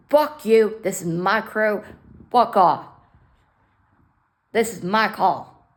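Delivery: loud, forceful swearing; angry telling off. Retold or imitated. To fire department at the door, loud angry telling-off. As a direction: bark them off your call.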